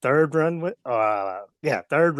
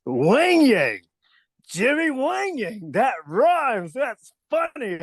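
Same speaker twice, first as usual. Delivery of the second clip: high, drawn-out mock boss delighted with himself; Vince McMahon; 10 semitones higher, much slower than usual. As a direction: stretch the name out high like an imitated boss. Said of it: "And said".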